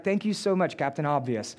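deadpan mock thanks, dropping at the end; dry sarcasm. Jesus answers Peter's obvious remark, dryly sarcastic. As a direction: thank him with understated sarcasm. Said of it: "that's kinda the way that Jesus is saying it".

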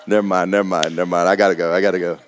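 laughing it off. Real, not acted. Preacher dropping the joke with a low chuckle. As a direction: wave it away through a laugh. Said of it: "laughing it off".

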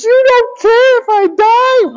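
put-on very high, slow, wailing child's voice; hammed-up whiny daughter. Daughter wailing at dad she might die, very high and slow. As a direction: stretch it out like a melodramatic little girl. Daughter's dramatic whine retold again.

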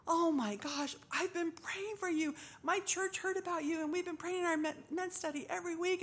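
high, bursting with excitement; excited. Retold or imitated. Stranger at the hospital tells her he prayed for her, high and excited. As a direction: gush it out like a thrilled stranger. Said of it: "And he was so excited".

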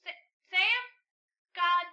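high, loud call after a friend; exasperated yell after someone running off; alarmed calling out. Acted. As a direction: shout the name in sudden alarm. Calls after fleeing friend.

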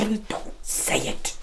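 slow, unvoiced hush; urgent whisper. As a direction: silence him before he says it. Fears microphones in the room.

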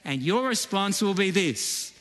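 ordinary talk

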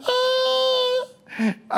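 very high, drawn-out comic bawl; mock wailing cry. Woman weeping in front of the gardener, mock wail. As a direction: imitate a woman wailing in a squeal. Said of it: "and she's crying".

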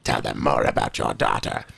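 deep, quiet, strange repeat; weird low voice. Acted. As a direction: drop into a deep odd voice.

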